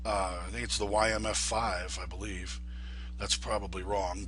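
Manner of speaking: ordinary talk, nothing marked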